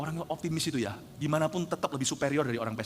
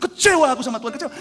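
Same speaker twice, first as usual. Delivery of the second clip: loud, high mock complaint at God; hammed-up grumble of a let-down believer; angry complaint; 9 semitones higher, 12 dB louder than usual. Acting out a disappointed believer.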